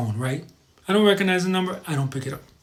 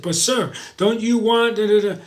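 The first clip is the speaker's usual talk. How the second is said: higher, louder mock sales pleading; pushy telemarketer; 6 semitones higher, 4 dB louder than usual. Telemarketer badgering a man who said no, pushy mock pitch. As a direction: pester them like a pushy salesman.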